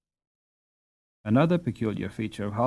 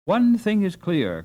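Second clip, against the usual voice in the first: raised, higher exclamation; exclaiming; 6 semitones higher than usual. Acted. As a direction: call it out with lively emphasis. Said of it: "cries Master Brightside".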